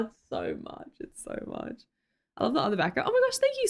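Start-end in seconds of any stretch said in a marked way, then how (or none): 0.1-1.8 whispered aside. Real, not acted. Whispered aside about matching sets.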